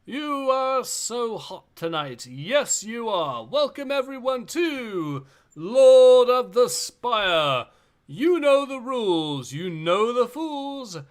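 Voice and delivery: lightly voiced arena announcer. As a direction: present the fighters like a show host. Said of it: "I'll do the voice of the announcer here".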